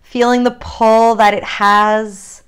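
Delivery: unhurried guided yoga cues with long pauses; calm grounding exercise. Acted. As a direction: lead them gently into their body.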